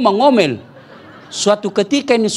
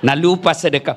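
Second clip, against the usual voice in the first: ordinary talk, nothing marked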